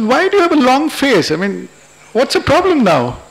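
high-pitched, amused probing; smiling, teasing question. Acted. Vishnu greeting the glum husband, smiling and high. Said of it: "Vishnu smiles at him and says".

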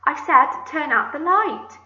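high, loud and slow angry shout. Acted. Centipede shouting at the sleeping glowworm, slow angry shout. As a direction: spell it out, fed up and shouting. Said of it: "shouted the centipede angrily".

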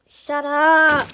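loud snapping shout; angry kid yelling at her sister; shouted shut up. Acted. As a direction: yell it at your sister.

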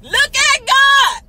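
high, loud and slow praise shout; joyful shout. Real, not acted. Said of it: "Listen, I'm loud".

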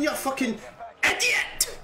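high-pitched shouted insult; theatrical outburst of mock outrage; mock furious yell. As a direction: yell it high, punching each word.

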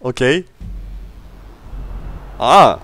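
ordinary delivery

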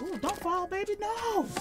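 panicked high coo at a tipping book; high-pitched squeak as a book tips over; alarmed squeal. Real, not acted. Item falling off shelf.

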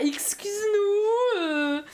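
drawn-out, high mock "excuse us"; réaction moqueuse imitée. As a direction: mimic sarcastic onlookers, stretching words loudly. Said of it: "ils réagissent comme ça". (imitation moqueuse, mots traînants et exagérés)

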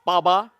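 পাবা with a clear b and a rising, questioning end; rural Bengali word. Shown as a demonstration. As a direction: repeat পাবা, asking if they've heard it. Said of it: "এই শব্দটি শুনেছেন কখনো".

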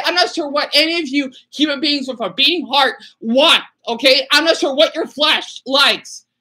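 high, loud, strained rant at the viewers; stressed outburst. Real, not acted. Stressed vlogger outburst, rising pitch.

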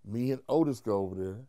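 ordinary talk, nothing marked

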